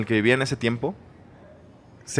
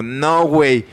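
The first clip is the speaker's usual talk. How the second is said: loud, slow, higher retort; aggressive; 5 semitones higher, 6 dB louder, slower than usual. Retold or imitated. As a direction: snap back with a bruised ego. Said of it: "yo empecé a contestar bien agresivo".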